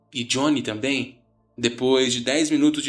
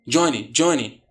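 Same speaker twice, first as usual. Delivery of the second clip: louder, insistent name-calling; firm, raised call; interrupting; 3 semitones higher, 5 dB louder than usual. A narrator's reading. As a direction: cut in to calm a friend. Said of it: "Eu tentei interromper".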